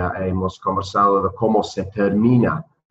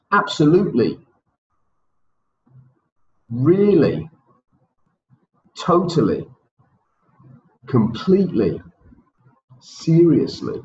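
each intensifier said higher with clear stress; emphatic drill; 6 semitones higher than usual. Shown as a demonstration. Teacher running the intensifier drill once more, emphatic. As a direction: model each word with energy. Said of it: "So one more time".

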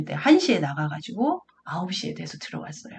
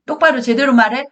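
raised, pressing mother voice; stern interrogation; 5 semitones higher, 5 dB louder than usual. Acted. Mother questions child who came home late, stern and pressing. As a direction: demand an answer like a cross parent. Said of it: "막 이런 식으로 아이에게".

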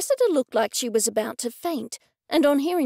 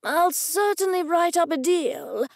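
higher-pitched, eager offer; excited; 6 semitones higher than usual. A narrator's reading. Gallery owner offers the artist a deal, excitedly. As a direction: beam as you offer it, pitch lifted. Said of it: "Mortimer said excitedly".

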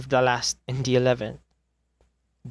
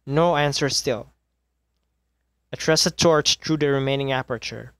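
usual speaking voice

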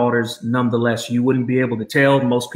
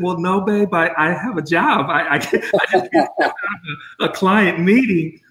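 higher, laughing through the words; laughing reply; 6 semitones higher than usual. Retold or imitated. Dad laughing as he tells his daughter he has a job. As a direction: laugh it off while explaining to her.

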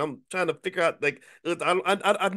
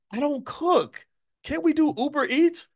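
high whiny protest; 14 semitones higher than usual. Retold or imitated. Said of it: "I'm like".

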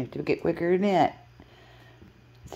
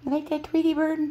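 high, louder coo to the bird; pet talk; 14 semitones higher, 5 dB louder than usual. Real, not acted. Cooing to the bird.

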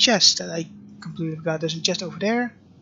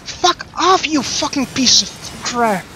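swearing tirade; 6 semitones higher than usual. Real, not acted. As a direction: rage at the game, real. Boss fight, swearing tirade.

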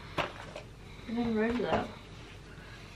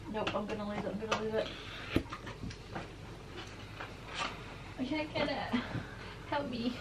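straining with effort; 10 dB quieter than usual. Real, not acted. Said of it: "I'm really struggling to get it out".

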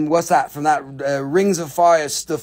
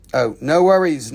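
ordinary talk

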